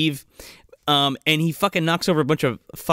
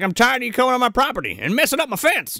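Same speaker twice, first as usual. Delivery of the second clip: quick, higher put-on teen voice; mock angry teen; 9 semitones higher, faster than usual. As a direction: rattle it off like a sulky kid. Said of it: "He's like".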